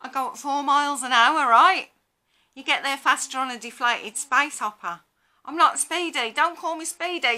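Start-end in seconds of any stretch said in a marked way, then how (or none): none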